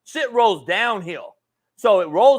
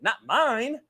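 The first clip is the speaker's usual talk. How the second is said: ordinary talk, nothing marked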